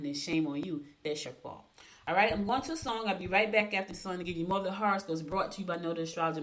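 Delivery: ordinary delivery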